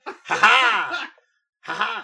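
loud gleeful ha ha; victorious cackle; triumphant ha ha. Real, not acted. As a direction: crow with delight. Triumphant laugh after quote.

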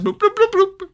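high bloop sound effect; high bloop noises. Pretend. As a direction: chirp it like a game blip. Imitating game sound effect.

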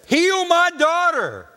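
high, drawn-out plea; acted crying out. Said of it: "Here's what Joy cries out".

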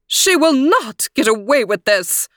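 raging high shout from the queen; furious queen scream. Acted. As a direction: scream the threat in fury. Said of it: "She screamed".

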